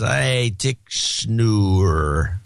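very slow, slurred words; slow drunk slur. Pretend. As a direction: drag it out drunkenly. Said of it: "Do the drunk voice".

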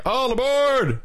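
very high boarding call; high-pitched train call; conductor's call, corrected. Shown as a demonstration. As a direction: sing out a high "all aboard". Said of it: "It's like".

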